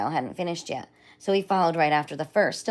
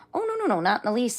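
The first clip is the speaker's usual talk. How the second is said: crocodile voice; 7 semitones higher than usual. Acted. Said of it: "said the crocodile".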